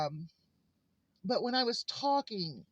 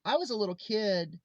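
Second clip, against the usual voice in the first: ordinary talk, nothing marked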